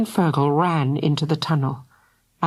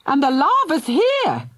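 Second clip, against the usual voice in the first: put-on high frightened child cry; 9 semitones higher than usual. A narrator's reading. Fergal cries out as the lava arrives. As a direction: cry out the danger. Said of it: "cried Fergal".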